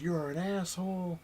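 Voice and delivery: high, slow drawn-out jeer; mock angry insult. Beaten kid sends abusive messages, mock furious. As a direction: mimic an irate kid, stretching the insult.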